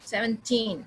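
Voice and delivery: put-on seventeen with long falling TEEN; seventeen, weight on last syllable; stress on TEEN. Shown as a demonstration. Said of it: "टीन पे".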